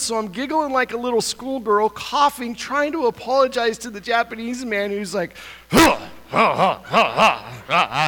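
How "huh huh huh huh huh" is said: breathy huh huh gasps of a soaked man; imitating a startled man's huffs; mock sputtering gasps. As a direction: sputter like you just got drenched. Japanese man choking sputter.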